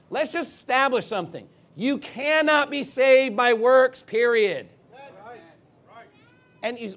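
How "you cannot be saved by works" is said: cannot hit high and loud, slowly; emphatic declaration. Real, not acted. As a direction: lay it down loudly, leaning on cannot. Preacher establishes his main point.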